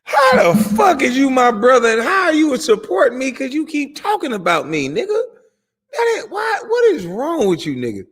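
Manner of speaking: shouting at the critic who calls himself his brother; angry outburst. Real, not acted. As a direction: yell back at him in disbelief.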